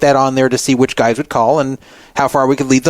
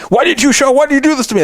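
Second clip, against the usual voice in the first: higher, whiny mock accusation; hammed-up hurt outrage, not how it really went; mock angry whine; 14 semitones higher, faster than usual. Imitating himself blaming the no-show date, mock whiny. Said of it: "So I wasn't mad".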